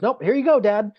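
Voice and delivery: brisk nurse. Retold or imitated. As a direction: play the nurse briskly handing the baby over. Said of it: "She goes".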